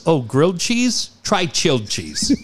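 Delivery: drawn-out deadpan pun, slowed down; dad delivering a pun to his son; slow punchline. Retold or imitated. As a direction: lay the joke out slowly, savouring it. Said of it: "What I said to him was".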